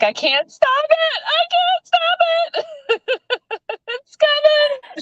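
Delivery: very high, drawn-out squeal; helpless mock wail. Co-host acting out tears she can't hold back, helpless high wail. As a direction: wail it as if tears are bursting out. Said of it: "once it starts".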